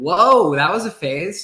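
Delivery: mock surprise. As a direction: play it as a stunned stranger seeing the old passport photo. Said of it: "they're like".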